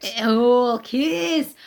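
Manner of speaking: slow imitation of a Scottish inspector; menacing Scottish accent. Co-host echoing the inspector in a menacing Scottish accent. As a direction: draw it out in mock Scottish. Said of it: "you cannae do a Scottish accent without a bit of menace".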